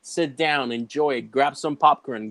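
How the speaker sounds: ordinary talk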